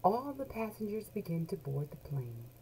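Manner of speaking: ordinary delivery, nothing marked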